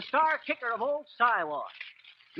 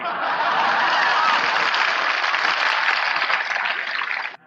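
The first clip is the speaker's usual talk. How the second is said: whooping laugh, much higher; high loud laugh; 6 dB louder than usual. Real, not acted. Delighted with his patter, whooping high laugh.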